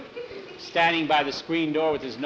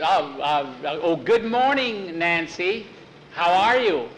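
flustered delight; 3 semitones higher, 4 dB louder, slower than usual. Acted. Jackie answering Nancy's greeting, flustered and eager. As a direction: greet her over-eagerly, pitched up and louder.

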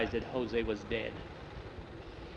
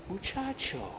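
put-on old grandmother; 7 semitones higher, 8 dB quieter than usual. Grandmother addresses the boy.

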